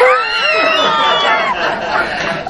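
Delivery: sudden high laughing shout. Real, not acted. Laughing aloud at the man asking for his paper back. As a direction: let a big laugh burst out.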